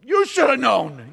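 loud, slow, laughing mock reproach; mock scolding. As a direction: drag it out, hammed-up blame. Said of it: "dual personality thing".

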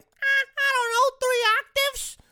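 loud, piercingly high squeal; mocking high voice. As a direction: squeal it way up top in mockery. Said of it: "lower it".

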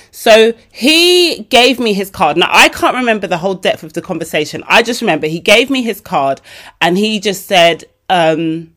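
ordinary talk, nothing marked

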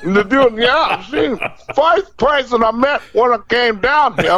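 laughing. Retold or imitated. Retelling Pryor routine laughing.

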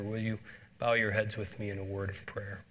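usual speaking voice

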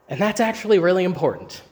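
ordinary talk

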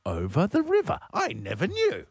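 high-pitched, put-on wonder; mock revelation. Host mocking someone who paid the expert, mock wonder. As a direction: act astonished by something utterly obvious. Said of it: "Someone has sat there and gone".

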